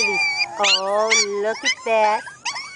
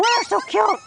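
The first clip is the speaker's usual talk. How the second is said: normal talking voice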